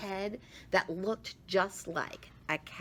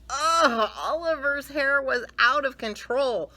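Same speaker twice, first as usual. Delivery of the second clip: disgusted; 9 semitones higher, 7 dB louder than usual. Acted. Disgusted at bedhead.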